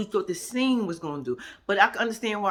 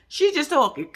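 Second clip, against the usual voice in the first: higher, louder, breaking into laughter; tickled and pitched up; amused outburst; 13 semitones higher, 4 dB louder than usual. Real, not acted. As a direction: crack up as you say it. Cracking up at Portia's words.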